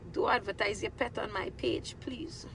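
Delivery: hushed warning, barely voiced; whisper. Real, not acted. Warning viewers off her page.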